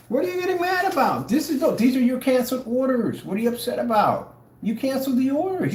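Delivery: high-pitched taunting questions; squeaky, needling protest; mock bafflement. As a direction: ask in exaggerated disbelief. Mock pleading with angry sellers.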